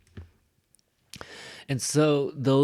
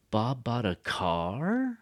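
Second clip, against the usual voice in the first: slow, "car" long and swooping up; drawn-out surprise landing on "car"; exaggerated stress on CAR; slower than usual. Shown as a demonstration.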